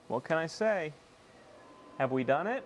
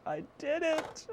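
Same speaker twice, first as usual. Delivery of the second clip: much higher, slower mock wail; 12 semitones higher, slower than usual. Real, not acted. Groaning to chat about breaking the cable again, higher mock wail. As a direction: wail it like a cartoon of despair.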